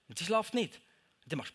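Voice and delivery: ordinary delivery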